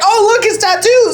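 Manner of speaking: high excited mock squeal; hammed-up distraction; mock excited daughters. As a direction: fake excitement to change the subject. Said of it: "they're like".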